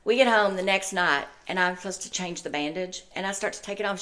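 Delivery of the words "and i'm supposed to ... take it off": ordinary talk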